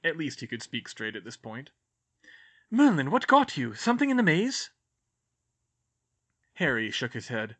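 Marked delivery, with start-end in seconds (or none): 2.7-4.7 higher, put-upon grumble; a fussy teacher out of her depth; lightly voiced flustered teacher. Sprout, alarmed.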